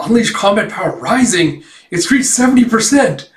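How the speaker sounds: high, excited alert voice; power-level announcement. Retold or imitated. Announcing combat power hitting seventy percent, high and excited. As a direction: call out the rising reading urgently.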